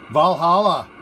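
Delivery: hyped reveal of the sausage; excited announcement. Real, not acted. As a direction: proudly present the dish by name. Toasting the sausage's name.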